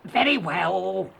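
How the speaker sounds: grudging troll, drawn-out words. A narrator's reading. Troll lets the youngest goat go, slow troll voice. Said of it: "said the troll".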